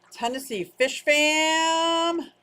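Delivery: loud, high, stretched-out call; rallying cheer. Real, not acted. Hyping up the Fish Fam, long loud cheer. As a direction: holler the name and hold the last word.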